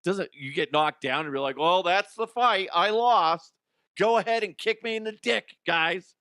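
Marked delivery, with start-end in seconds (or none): 1.6-6.2 loud, high mock protest; mock quitter's outburst. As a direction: give up loudly, hammed-up and high.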